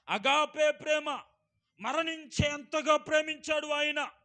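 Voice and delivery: loud, ringing pulpit voice; preaching at full force. Acted. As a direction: preach it out to the whole hall. Preacher proclaims agape love.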